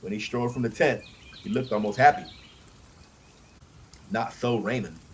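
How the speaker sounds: ordinary delivery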